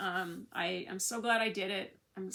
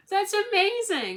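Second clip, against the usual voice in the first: much higher, louder, slow amazed praise; delighted exclaim; 12 semitones higher, 12 dB louder, slower than usual. Retold or imitated. Imitating doctor admiring her tattoo, loud high delighted praise. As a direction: gush it out like you can't believe it.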